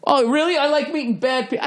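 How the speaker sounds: high, sneering parody of the guy; mocking parody. Comedian mocking a backstage fan's line, high sarcastic parody. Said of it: "what the fuck does that mean?".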